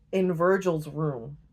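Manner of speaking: ordinary talk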